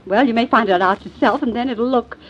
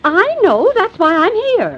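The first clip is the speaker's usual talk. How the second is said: much higher, slow emphatic insistence; insistent; 9 semitones higher, slower than usual. Acted. Alma cuts off the busy sheriff, high and insistent. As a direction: cut him off and insist.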